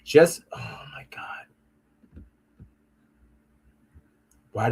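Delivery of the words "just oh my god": exasperated whisper. Real, not acted. Exasperated at failing website.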